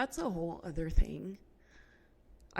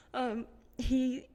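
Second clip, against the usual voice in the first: higher, teary voice breaking; crying; 5 semitones higher, 6 dB louder than usual. Real, not acted. Host to listeners about her husband, crying. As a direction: talk through real tears. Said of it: "I did not think I was gonna cry".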